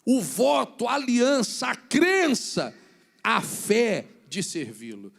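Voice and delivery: loud, slow emphatic sermon; preaching at full force. Real, not acted. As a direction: drive each word home from the pulpit. Pregação em voz forte. (pregação enfática e lenta)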